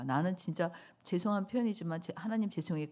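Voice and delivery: ordinary talk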